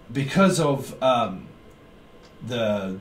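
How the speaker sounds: normal talking voice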